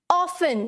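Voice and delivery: often said as OFF-un, no T sound; often pronounced without its T; often with silent T. Shown as a demonstration. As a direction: say often like "awfun", the T left silent. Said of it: "पहला word मेरा क्या है".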